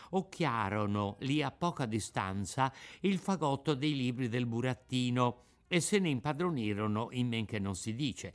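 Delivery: usual speaking voice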